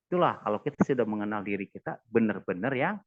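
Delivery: normal talking voice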